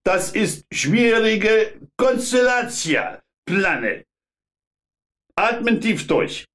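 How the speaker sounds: put-on high, hard Polish-accented inspector. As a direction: bark it in rough Polish-accented German.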